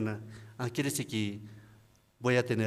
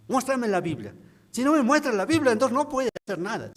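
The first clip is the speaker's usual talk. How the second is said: high, louder, fast demand; angry challenge; 11 semitones higher, 4 dB louder than usual. Retold or imitated. Angry deacon demanding proof from the Bible, loud. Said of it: "se ha enojado conmigo".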